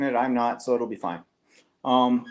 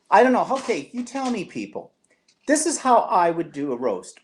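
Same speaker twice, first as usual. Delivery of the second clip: usual speaking voice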